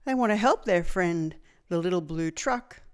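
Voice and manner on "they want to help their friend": plain reading voice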